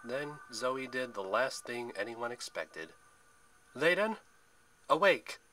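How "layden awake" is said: loud, high commanding call; rousing call. A narrator's reading. Zoe wakes the dragon, loud high summons. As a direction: call out to wake a beast. Calling the sleeping dragon awake.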